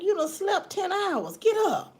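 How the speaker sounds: high-pitched mock scolding, tipping into a laugh; amused, high-voiced disbelief; exasperated mom. Retold exasperated reply to sleepy daughter.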